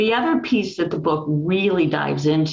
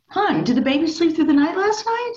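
much higher, put-on voice for the oblivious husband; high-pitched mock husband asking sweetly; mock clueless husband; 12 semitones higher than usual. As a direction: play the sleepy dad, innocently clueless. Wife retells her husband's morning question.

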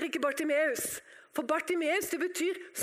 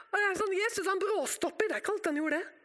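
normal talking voice

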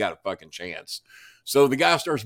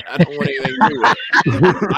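quick, louder recoil of disgust; mock-horrified no thanks; disgusted refusal; 3 semitones higher, 9 dB louder, faster than usual. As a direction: push the chain away with your voice. Refusing the thief's gold chain.

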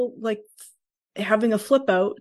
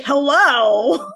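sarcastic hello; 5 semitones higher, 5 dB louder than usual. Retold or imitated. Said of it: "She's like".

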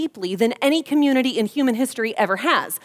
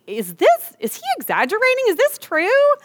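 incredulous question; 11 semitones higher than usual. Retold or imitated. Mother asking teachers if the bullying is true, incredulous and much higher. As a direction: pitch jumps way higher on the disbelieving questions.

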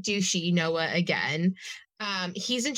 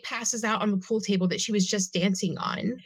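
normal talking voice

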